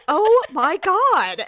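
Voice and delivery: slow, drawn out gasp of disbelief; imitating a friend's slow oh my God; stunned disbelief. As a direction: stretch it in shock. [name] cracking up.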